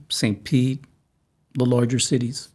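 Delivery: ordinary talk, nothing marked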